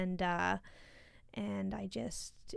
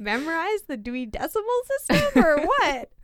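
incredulous; 8 semitones higher, 10 dB louder than usual. Retold or imitated. Retelling incredulous child question.

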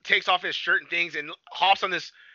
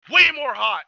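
normal talking voice

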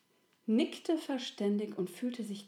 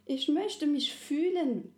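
high, slow yearning little soul; small soul voice; 7 semitones higher, 4 dB louder than usual. A narrator's reading. The little soul tells Resi its wish, high and slow. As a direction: wish it aloud like a small child.

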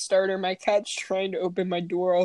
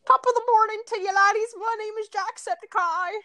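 very high, fast mock British-Irish greeting; Jacksepticeye; 13 semitones higher, 3 dB louder than usual. Shown as a demonstration. Showing off a bitchy British accent, shrieky and high-pitched. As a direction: squeal out a hammy British accent. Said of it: "so a bitchy British accent".

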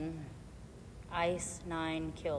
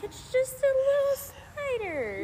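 high, slow mock-soothing; 5 dB louder, slower than usual. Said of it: "And I'm like".

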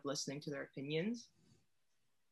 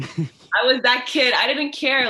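loud, higher, proudly owning it; emphatic outburst; 5 semitones higher, 11 dB louder, slower than usual. Real, not acted. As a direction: belt it out with conviction. Emphatic repeat, owning being that kid.

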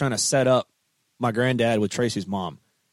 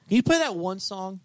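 higher-pitched mock request; mimicked pestering fan; 8 semitones higher than usual. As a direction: imitate an eager fan asking. Mimicking pestering fans' song request.

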